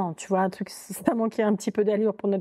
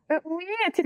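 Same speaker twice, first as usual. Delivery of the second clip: higher, mock-coy phone answer; fake put-on voice; 9 semitones higher than usual. Retelling her phone answer to him in a fake mijaurée voice. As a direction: put on the voice that doesn't exist. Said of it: "la voix bidon".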